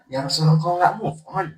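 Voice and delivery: high, loud playful exclamation; teasing mock complaint. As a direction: rib him comically, about to laugh. Joking modern paraphrase of companion's remark. (godaan jenaka, lantang dan tinggi)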